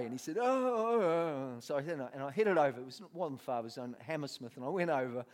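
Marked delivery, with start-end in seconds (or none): 0.4-1.6 high moan sinking down; mock groaning. Addict answers the offer of prayer, mock pained moaning. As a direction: groan pitifully, hammed up and falling away.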